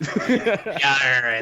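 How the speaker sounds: hearty pirate growl with a laugh; a laughing pirate's Yarr. Pretend. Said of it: "Can you read it in a pirate voice?".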